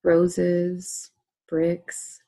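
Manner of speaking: slow, measured script reading with long pauses; unhurried relaxation-script voice, letting each phrase settle; guided meditation. Acted. Leads a guided colour relaxation.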